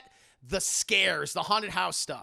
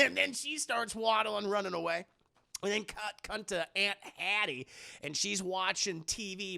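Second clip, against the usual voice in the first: ordinary delivery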